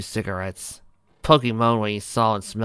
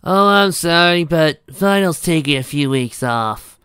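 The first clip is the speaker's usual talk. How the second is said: high, slow, mildly sad character voice; a touch of sadness, loud and drawn out; sadly apologetic; 7 semitones higher, 8 dB louder, slower than usual. A narrator's reading. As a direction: break the bad news slowly, pitched up. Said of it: "the mayor said sadly".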